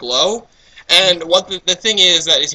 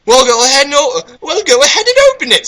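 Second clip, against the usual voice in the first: put-on high, loud encouraging girl; high-pitched eager girl; 11 semitones higher, 6 dB louder than usual. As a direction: urge him on in a girly pitch. Voicing Twilight, high.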